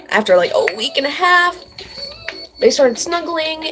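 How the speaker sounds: usual speaking voice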